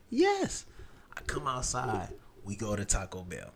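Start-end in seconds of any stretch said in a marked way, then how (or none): none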